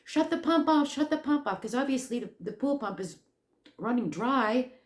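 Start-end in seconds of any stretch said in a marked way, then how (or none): 0.0-1.6 high urgent shout, fading on the repeat; retold yell. Panicking at Stephen by the pool, urgent shout. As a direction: yell it to stop the pump running dry. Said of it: "I'm yelling".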